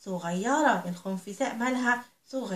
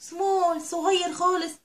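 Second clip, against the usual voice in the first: "small" and "صغير خالص" said slowly and higher; slow emphatic small; 6 semitones higher, much slower than usual. Shown as a demonstration. Teacher stressing to children how small the mouse is. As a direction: stretch "صغير خالص" out to show how tiny.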